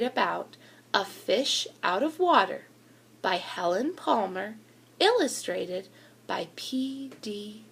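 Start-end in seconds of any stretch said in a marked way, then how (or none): none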